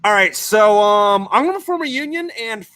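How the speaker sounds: hammed-up rebel telling God off; mock defiant devil. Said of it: "the devil's like".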